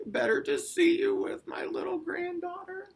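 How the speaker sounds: very high falsetto granny; exaggerated high mock-grandma; wolf pretending Grandma. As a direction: croon it in a squeaky fake-granny voice. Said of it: "pretended like he was Grandma".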